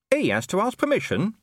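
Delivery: high scrappy little dog voice; small dog voice. Acted. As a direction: squeak it like a nervous mutt. Gaspode the dog speaks.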